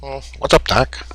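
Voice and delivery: a hint of a wisecracking cartoon bunny greeting; casual nod to the classic rabbit catchphrase; Bugs Bunny. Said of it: "Bugs Bunny".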